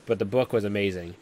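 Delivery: ordinary delivery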